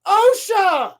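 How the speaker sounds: loud, high shouted names; urgent mock hollering; imitated calling out. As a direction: holler for the girls one by one. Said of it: "And he's like".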